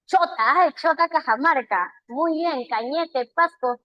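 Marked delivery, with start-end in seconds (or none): none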